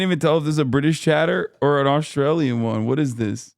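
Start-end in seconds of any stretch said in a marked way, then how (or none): none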